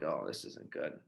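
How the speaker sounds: low, soft muttered dread under the breath; sinking realisation, kept quietly to himself. Retold or imitated. To himself, facing coach and dean: quiet dread.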